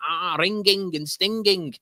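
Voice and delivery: high, drawn-out mock Mancunian; Mancunian accent. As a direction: exaggerate a Manc lad's slow, high twang. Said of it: "this lad who were talking like that".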